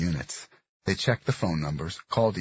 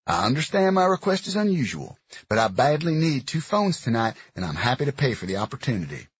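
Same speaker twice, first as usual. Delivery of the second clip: gruff Southern man; 5 semitones higher, 4 dB louder than usual. Acted. Dox offering money.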